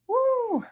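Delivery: high, sudden cheer of joy; excited whoop. Real, not acted. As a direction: whoop as the numbers come in. Cheering the rising prices.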